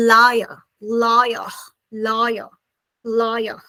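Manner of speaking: British liar with the r dropped; British pronunciation of liar; British accent. Shown as a demonstration. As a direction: end liar on an open vowel, British style. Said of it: "British people say".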